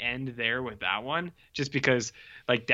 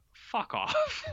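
high, rising incredulous fuck off; squeaky disbelieving brush-off; mock exasperated dismissal; 11 semitones higher, 4 dB quieter than usual. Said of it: "my last note was".

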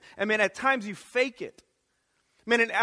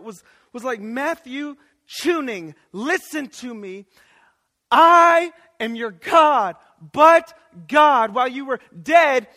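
raised, forceful sermon delivery; impassioned preaching; 4 semitones higher, 8 dB louder than usual. Real, not acted. Preacher proclaiming his identity in Jesus, impassioned preaching. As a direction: build to a loud, emphatic proclamation.

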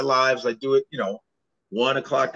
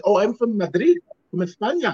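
put-on higher, proud Spanish-accented boast; proud Spaniard; 6 semitones higher than usual. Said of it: "she's like".

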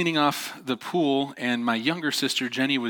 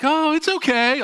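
mock reassurance; 5 dB louder than usual. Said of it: "I'm like".

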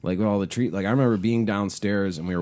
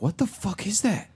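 startled outburst; 12 semitones higher than usual. Retold or imitated. Retold shock at loud cicadas.

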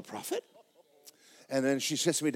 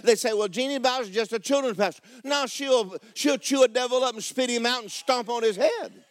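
full-force preaching; 10 semitones higher, 4 dB louder than usual. Acted.

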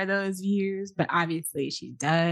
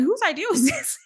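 incredulous; 11 semitones higher, faster than usual. Retold or imitated. Reacting in disbelief to shoot.

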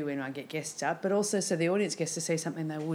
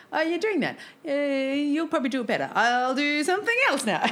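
much higher, louder put-on voice of giving way; mock deflated self; 9 semitones higher, 3 dB louder than usual. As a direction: play up backing off to a friend. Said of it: "chat to a friend and go".

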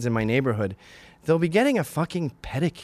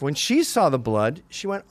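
normal talking voice

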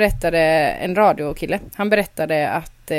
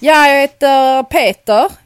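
put-on higher, louder, slow Skåne accent; fake Skåne accent; 6 semitones higher, 9 dB louder, slower than usual. Nervous new boy in class introducing himself, in a faked Skåne accent. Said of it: "På skånska".

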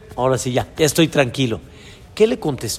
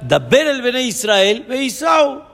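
much higher, urgent call; God speaking to Moses; 5 semitones higher than usual. Retold or imitated. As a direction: urge Moses on as an impatient God. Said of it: "Vayomer Hashem el Moshe".

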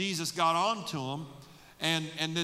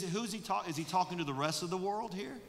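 usual speaking voice

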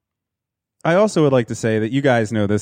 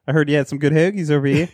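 higher-pitched hammed-up Philly accent; 4 semitones higher than usual. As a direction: keep up the joking Philly local act. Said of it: "I'd be like".

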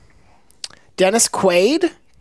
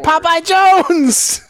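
loud, high, drawn-out holler; shouted name; 9 semitones higher, 5 dB louder, much slower than usual. Shown as a demonstration. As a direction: stretch the name into a game call. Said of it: "you gotta be like".

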